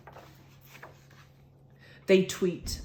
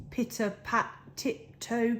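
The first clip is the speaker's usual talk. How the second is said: ordinary delivery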